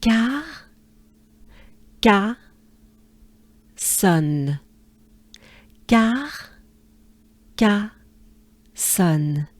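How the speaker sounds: "Car, ca, son" split into separate beats; syllable by syllable. Shown as a demonstration. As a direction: break Carcassonne into its three syllables. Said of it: "let's go into the consonants".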